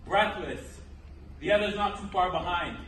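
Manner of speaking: ordinary delivery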